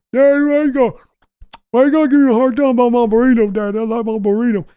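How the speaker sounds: high, loud put-on son's voice; Steven answering. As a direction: answer Daddy like a cowed grown son. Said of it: "yelling at Steven".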